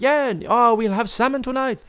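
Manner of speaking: high, gleeful grandpa impression; hammed-up high old-man excitement; excited old pop. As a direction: announce it joyfully in a high put-on voice. Imitates pop excited about dinner.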